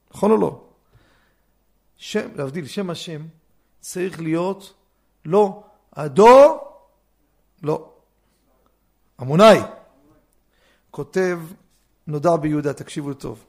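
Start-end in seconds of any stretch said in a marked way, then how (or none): none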